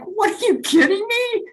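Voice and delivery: mock outrage at leaving food; incredulous. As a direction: act stunned anyone would stop eating. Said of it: "I'm like".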